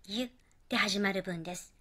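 ordinary talk, nothing marked

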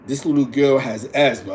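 ordinary talk

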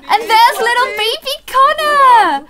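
high, gushing coo with a gasp; delighted gasp. Real, not acted. Streamer seeing the newborn sim, gushing high with delight. First sight of new baby.

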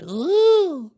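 loud, very high drawn-out ooh; jolted-upward squeal of ooh; high ooh as if tossed in the air; high rising "ooh". A narrator's reading. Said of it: "as he went up in the air".

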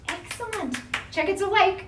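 ordinary talk, nothing marked

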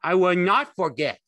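high, loud denial; loud protest. A narrator's reading. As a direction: protest at full voice. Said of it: "Lenny said loudly".

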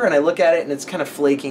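usual speaking voice

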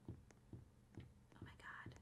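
slow voiceless hush; whisper. Real, not acted. Hiding from the chaser, whispered. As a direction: breathe it so he can't hear.

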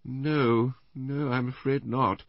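high, slowing, reluctant denial. A narrator's reading. Wife admitting she doesn't know the man, reluctant. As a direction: draw out the denial regretfully. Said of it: "said Missus Bland with obvious reluctance".

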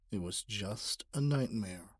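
usual speaking voice

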